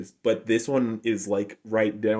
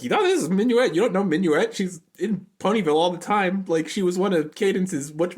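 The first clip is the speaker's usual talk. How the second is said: much higher, bubbly imitation of Pinkie; 8 semitones higher than usual. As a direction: chirp it out like an excited cartoon pony. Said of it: "Pinkie's like".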